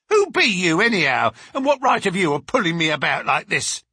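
loud, rustic servant's challenge; indignant servant woman. Acted. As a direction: demand angrily who has dared grab you. Voices the indignant servant Susan.

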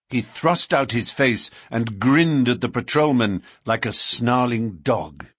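ordinary delivery, nothing marked

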